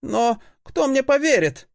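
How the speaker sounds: put-on high young voice, blurting; blurted out. A narrator's reading. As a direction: burst out with the doubt. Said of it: "затем выпалил".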